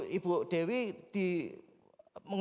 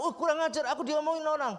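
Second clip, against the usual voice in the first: indignant outburst; 7 semitones higher, faster than usual. Retold or imitated. Man told he's gossiped about, mock outraged high outburst. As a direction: pitch jumps way up in mock outrage.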